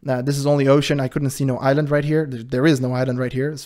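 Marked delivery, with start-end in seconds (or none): none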